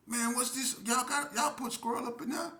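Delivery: high-pitched, incredulous questioning; retold high-voiced complaint; mock confrontation. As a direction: demand an answer in a high voice. Confronting the food sellers.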